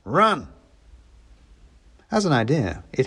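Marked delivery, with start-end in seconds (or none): 0.0-0.5 sudden urgent shout. A narrator's reading. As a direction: yell it as you bolt. Hero flees from cat.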